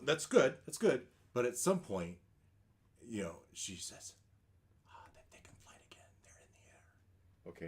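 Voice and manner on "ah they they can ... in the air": fast hushed warning whisper. Acted. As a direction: whisper the alarm. NPC warns in hush.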